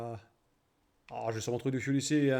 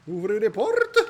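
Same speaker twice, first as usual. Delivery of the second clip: laughing shout; 6 semitones higher, 10 dB louder than usual. Pretend.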